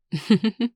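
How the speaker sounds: amused chuckle. Acted. Laughing off the caller's semantics, amused chuckle. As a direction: chuckle at their excuse.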